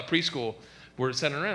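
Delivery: ordinary delivery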